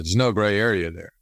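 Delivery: usual speaking voice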